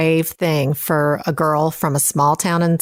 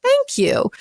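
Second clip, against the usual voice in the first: much higher, sing-song little thank you; mock modest thanks. Brushing off praise for her school writing awards, high and coy. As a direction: play it off sweetly, pitched way up. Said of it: "I was like".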